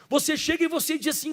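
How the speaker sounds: normal talking voice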